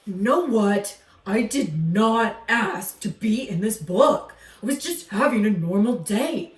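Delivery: a gruff alligator defending himself; grumpy alligator voice. Acted.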